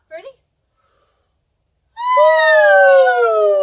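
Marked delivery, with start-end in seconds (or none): 2.3-3.6 a loud ooh that starts much higher and slides down; high vocal siren. Shown as a demonstration. Teacher demonstrating a siren from the top, loud sliding ooh. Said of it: "Start from the top".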